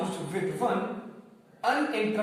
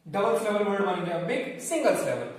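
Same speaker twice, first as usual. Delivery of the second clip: normal talking voice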